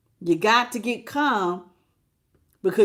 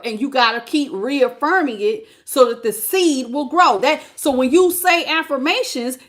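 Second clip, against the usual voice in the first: higher, louder preaching build; 5 semitones higher, 5 dB louder than usual. Acted. Preacher urging listeners to water the seed, preaching at full force. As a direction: preach it out with fire.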